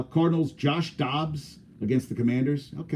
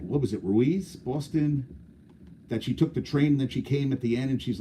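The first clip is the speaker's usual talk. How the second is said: ordinary talk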